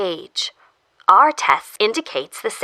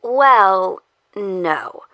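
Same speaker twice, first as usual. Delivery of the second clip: hesitant; 10 semitones higher than usual. A narrator's reading. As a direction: break it gently, with a pause before the no. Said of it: "The voice hesitates".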